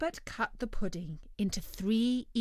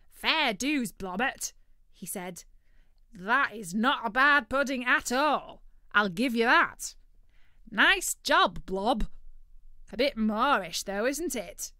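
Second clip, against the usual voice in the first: loud, high, cheerful bird voice; greedy turkey praising the chef; 4 semitones higher, 8 dB louder than usual. Acted.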